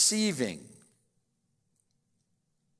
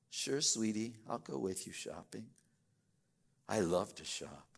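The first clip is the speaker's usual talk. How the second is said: soft, lower, syrupy mock sweetness; imitating a smitten boyfriend agreeing; sugary boyfriend; 6 semitones lower, 8 dB quieter than usual. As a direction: play the eager-to-please suitor. Boyfriend's sweet lying reply.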